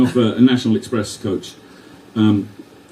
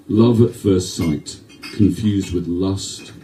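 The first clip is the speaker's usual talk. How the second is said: poem recital; 4 semitones lower than usual. Acted. Recites a rhyming love poem.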